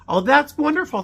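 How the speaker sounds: high, bright cry of real delight; delighted exclamation. Real, not acted. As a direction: gush over a lovely gift. Reacting to a gift card.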